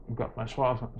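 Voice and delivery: ordinary delivery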